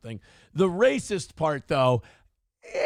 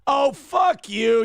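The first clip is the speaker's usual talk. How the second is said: slow, loud and higher, yelled at the screen; shouted insult; 10 semitones higher, 7 dB louder, much slower than usual. Real, not acted. Host yells back at a student in a news clip. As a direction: bark it out at the screen, slowly.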